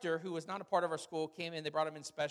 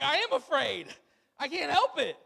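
higher, louder whiny protest, slowed; mock pleading protest; 8 semitones higher, 9 dB louder than usual. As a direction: complain loudly that it doesn't help. Disciples answer Jesus, mocked.

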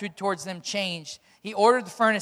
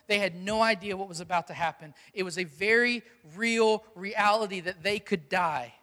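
ordinary delivery

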